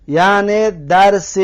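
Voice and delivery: ordinary delivery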